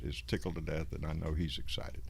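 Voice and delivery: usual speaking voice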